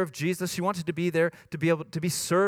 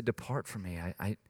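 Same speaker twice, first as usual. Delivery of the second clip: low, quiet acted verdict; hushed, sober dismissal; grave rejection; 6 semitones lower, 5 dB quieter than usual. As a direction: turn them away softly and low. Jesus answering the pleaders.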